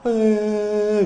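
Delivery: a high, loud pained cry of "eh"; a sudden cry of distress; short high wail; anguished eh. Shown as a demonstration. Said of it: "Atau A itu seperti kita kayak".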